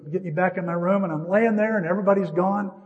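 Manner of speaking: ordinary talk, nothing marked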